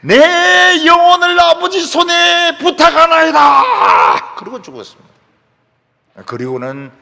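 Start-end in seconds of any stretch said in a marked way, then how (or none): 0.0-4.3 long drawn-out, high shouted prayer; slow, anguished-sized shout to the heavens; loud cry. Retold or imitated. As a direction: cry it out at full voice, stretching the words. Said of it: "큰 소리를 질렀습니다".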